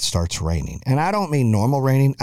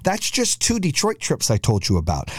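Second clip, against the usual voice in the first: usual speaking voice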